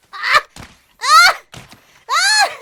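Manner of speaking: sharp rising yelps; excited screams. Real, not acted. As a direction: yelp as the shot misses. Missing the Disco Choppa shot.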